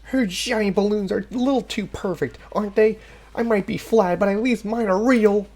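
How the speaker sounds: put-on high, fast, snippy girl; bratty girl voice. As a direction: sneer and brag like a brat.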